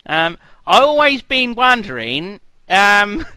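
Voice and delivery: high, loud bad Australian accent; mock Australian accent. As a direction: drawl it in a terrible Australian accent. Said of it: "terrible accent".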